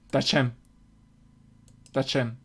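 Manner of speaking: very soft, low murmur; hushed. Real, not acted. Gamer murmurs the command to the screen, hushed. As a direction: say it under your breath. Drops to tiny voice.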